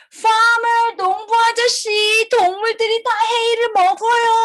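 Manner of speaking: high-pitched urgent call; calling the farmer. Acted. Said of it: "we need to hurry to find the farmer".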